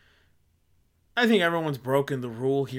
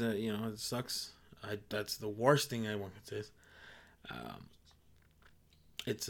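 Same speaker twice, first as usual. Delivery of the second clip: softer, lower sincere condolences; 5 semitones lower, 7 dB quieter than usual. Real, not acted. Offering condolences to those who lost loved ones, soft and sincere. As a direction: speak gently to the bereaved.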